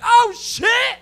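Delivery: very high, loud mock scream; acted alarmed shout. Comic as passenger witnessing road rage, mock-alarmed scream. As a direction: yell it in hammed-up shock.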